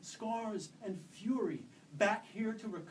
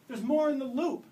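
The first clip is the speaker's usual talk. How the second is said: loud, high warning shout; girl warning her mother of more centaurs, yelling; alarmed yell; 8 semitones higher, 13 dB louder than usual. Acted. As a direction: cry out the danger ahead. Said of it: "she yelled".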